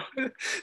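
short high nervous laugh. Real, not acted. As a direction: laugh it off, a little embarrassed. Nervous laugh while retelling.

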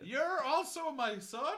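high, loud, slow rising disbelief; shocked question. Acted. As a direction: ham the second twist. Soap-opera shock at reveal.

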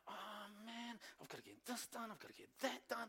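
a quiet inner complaint under the breath. Retold or imitated. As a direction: grumble to yourself so no one hears. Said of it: "On the inside".

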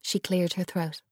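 ordinary delivery

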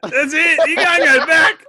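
high, loud cry of victory; gleeful shout. Real, not acted. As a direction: crow it loudly over your rival's blunder. Opponent gleefully calls out the slip.

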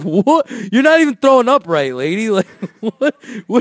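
high, fast, loud disbelief; incredulous mockery. Mocking the lady.

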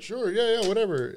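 lightly voiced sloppy drunk shrugging yes; mock drunk agreement. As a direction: toss it off like a drunk who doesn't care. Voicing the blacked-out drunk guy.

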